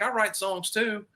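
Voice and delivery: high, slow imitated South African accent. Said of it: "he talked with a South African accent".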